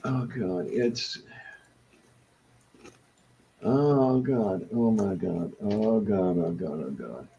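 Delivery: soft moans fading away; pained groaning. Real, not acted. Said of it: "in agony".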